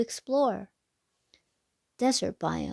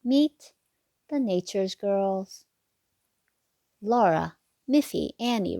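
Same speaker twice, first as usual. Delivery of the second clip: put-on lilting sing-song read to little kids; bouncy storybook rhyme voice; reading to children; 4 semitones higher than usual. A narrator's reading. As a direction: read the rhyme like a bedtime picture book. Rhyming picture book read aloud.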